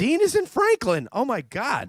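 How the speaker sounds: very high, incredulous correction; exasperated. Real, not acted. Host correcting co-host on where Dean is, exasperated. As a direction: squeal it in playful disbelief. Exasperated at co-host's geography question.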